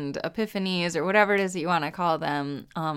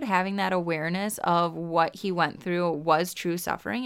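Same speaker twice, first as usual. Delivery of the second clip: ordinary talk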